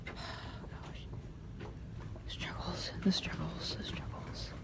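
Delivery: whispered with a weary sigh. Real, not acted. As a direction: breathe it out, worn down. Sighing over failed shirt search.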